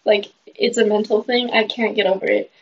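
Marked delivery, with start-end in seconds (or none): none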